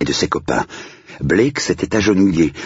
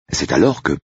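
plain reading voice

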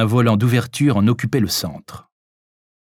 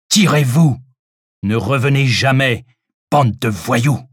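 forceful, raised order to get out; angry dismissal; 3 semitones higher, 4 dB louder than usual. A narrator's reading. Hidden stranger chasing off intruders, angry. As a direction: chase them off with an insult.

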